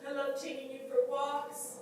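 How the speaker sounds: high, doting voice full of loss; fond, halting goodbye to a beloved pet; tender farewell. Acted. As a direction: coo lovingly upward, pausing between memories. Tender farewell to her dead dog.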